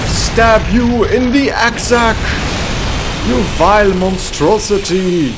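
loud, high, slow battle yell; battle cry. Acted. A knight attacks a crab monster, loud battle cry. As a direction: roar it as you strike.

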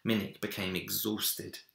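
ordinary delivery, nothing marked

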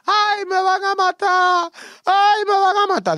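high, loud, slow wailing plea; terrified scream. Retold or imitated. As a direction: scream begging for his life. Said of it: "Rafael gritaba". (grito aterrado, agudo y lento)

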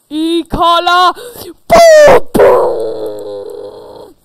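high, loud cry of the spell; climactic spell cry. Acted. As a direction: cast it with a final blast.

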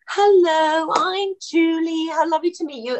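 high, effusive self-introduction; gracious greeting. Retold or imitated. Star introducing herself to a new colleague, gracious and high. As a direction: greet a newcomer openly, pitched well up. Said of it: "she immediately goes".

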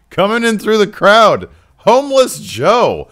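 loud, high ring-announcer hype; wrestling announcer. Acted. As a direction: boom out a big wrestler entrance, stretching the words. Hyped wrestling ring announcer introduction.

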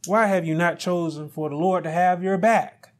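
usual speaking voice

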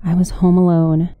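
plain reading voice

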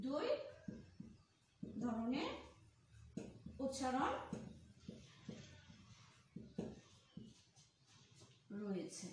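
one word at a time, long gaps; widely spaced words, falling at the end; slow dictation. Shown as a demonstration. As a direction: dictate for note-takers, pausing after each word. Teacher dictates while writing it out.

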